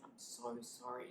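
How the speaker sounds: low, soft, subdued apology; retold tearful apology. Midwife holding the bereaved mother, retold tearful apology. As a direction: drop low and quiet, grieving. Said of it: "She's crying also".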